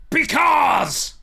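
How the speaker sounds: huge high yell on Because; full-throat promo scream; impassioned shout. Retold or imitated. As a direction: explode the word Because. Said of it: "impassioned pronunciation of the word because".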